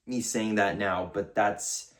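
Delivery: usual speaking voice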